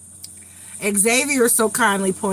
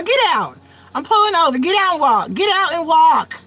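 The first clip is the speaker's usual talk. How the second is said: high, outraged retold command; indignant order; 6 semitones higher, 4 dB louder than usual. Driver retelling putting the child out of the car, indignant and high. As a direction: order him out, pitched up and offended.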